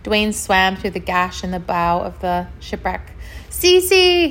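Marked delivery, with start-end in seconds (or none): 3.5-4.3 high, loud call; crying out. A narrator's reading. Dwayne searching the shipwreck for Cece, crying out. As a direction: call out the name urgently. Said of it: "he cried".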